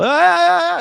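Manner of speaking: high, loud sustained "ah"; mock call to prayer. Man mimicking a noisy call to prayer, high wail. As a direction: wail one long high note over your talk.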